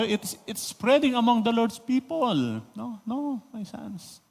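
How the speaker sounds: very soft, sorrowful plea; a hushed, broken-hearted no; grieving father. Shown as a demonstration. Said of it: "Yung tono?". (halos pabulong na pagdadalamhati ng ama)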